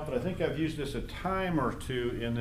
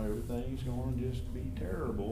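a hint of mopey Eeyore, a bit softer; gloomy Eeyore; 3 semitones lower, 9 dB quieter than usual. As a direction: sag into it like Eeyore. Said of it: "the old Eeyore kind of a thing".

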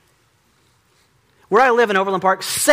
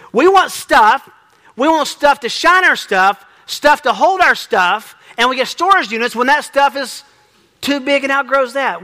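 louder, higher preaching; preaching at full force; 7 semitones higher, 6 dB louder than usual. Acted. Preacher telling the congregation men love stuff, at full force. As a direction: drive the point home from the pulpit.

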